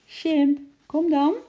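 high, loud beckoning call. A narrator's reading. Lotje reaching out to her monkey, calling. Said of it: "riep Lotje".

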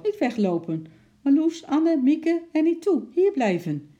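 a bossy woman hollering names; firm shouting. A narrator's reading. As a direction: shout the names after runaway girls. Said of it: "riep een kordate vrouwenstem".

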